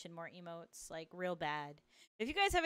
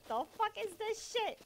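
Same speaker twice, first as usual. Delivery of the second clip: mock outrage; 13 semitones higher than usual. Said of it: "I'm like".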